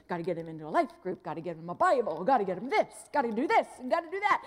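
racing mind, building up; racing thoughts. Retold or imitated. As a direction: rattle off the list in a hurry.

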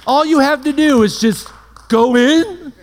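mock outrage from a saint who could only dream of it; incredulous Old Testament saint. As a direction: play an ancient believer stunned at how easy we have it. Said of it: "They'd look at us like".

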